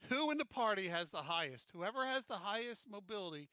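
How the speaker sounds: normal talking voice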